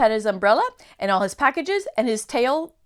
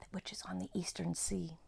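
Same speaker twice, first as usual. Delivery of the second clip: hushed mysterious whisper; secretive whisper; 3 semitones lower, 15 dB quieter than usual. Acted. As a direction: breathe it like a secret.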